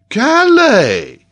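high, loud, drawn-out exclamation; astonished cry. Acted. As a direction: cry out in utter disbelief. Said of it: "cried Paganel".

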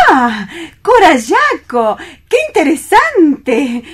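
flattered to hear herself in his story; a vain singer gushing at her own name; delighted exclamation. Acted. Singer thrilled to be the subject.

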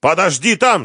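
high, loud panicked shout; urgent high-pitched cry. A narrator's reading. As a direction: yell to keep someone out. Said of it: "закричал Семён".

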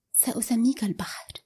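ordinary delivery, nothing marked

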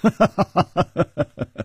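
high, acted staccato laugh. A narrator's reading. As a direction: burst into a long run of ha-ha-ha. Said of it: "却笑着说".

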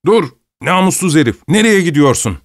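high, loud furious yell while running after the cart; furious shout. Acted. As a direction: bellow after the fleeing cart. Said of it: "diye haykırarak koşuyordu". (arabanın ardından koşarken yüksek perdeden öfkeli haykırış)

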